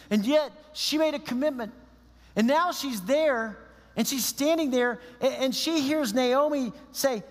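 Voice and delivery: high-pitched, urgent sermon storytelling; impassioned preaching. Acted. Preaches the story with rising intensity.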